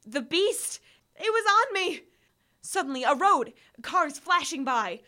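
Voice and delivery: high, loud alarm; frantic panic. A narrator's reading. Narrator as the beast catches him at the road, loud frantic alarm. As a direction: blurt it out as the beast lands on you.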